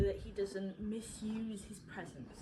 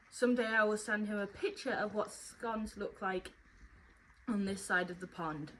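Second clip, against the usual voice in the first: ordinary talk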